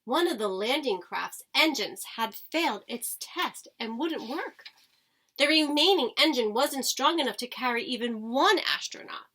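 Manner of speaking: ordinary delivery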